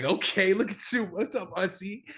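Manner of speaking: light up at seeing her, pitch jumping up; excited greeting. Retold or imitated. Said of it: "and I'm like".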